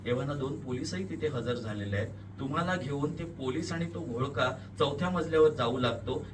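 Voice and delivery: usual speaking voice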